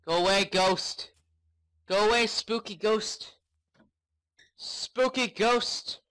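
drawn-out, high call to drive it away; shooing shout. Real, not acted. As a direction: shout to scare it off. Shooing a ghost in game.

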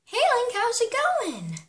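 Zelda voice. Acted. Zelda greets Link sweetly at the door.

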